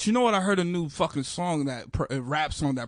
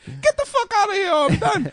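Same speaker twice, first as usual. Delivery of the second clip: much higher, louder mock shout of disgust; mock outraged yell; 7 dB louder than usual. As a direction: yell it like she's storming out for good. Imagined woman storming off.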